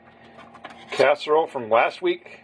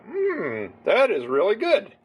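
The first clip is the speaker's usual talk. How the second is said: higher-pitched savouring "hmm" of approval; pleasantly impressed; 7 semitones higher than usual. Retold or imitated. Trying hummus at a friend's suggestion, pleasantly impressed. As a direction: recall a pleased first taste, pitch lifted. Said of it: "I thought".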